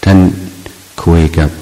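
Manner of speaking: ordinary talk, nothing marked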